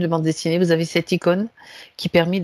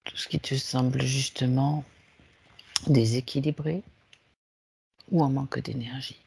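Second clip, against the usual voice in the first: guided meditation; 3 semitones lower, 7 dB quieter than usual. Acted. Leads a guided relaxation. (voix douce de méditation guidée)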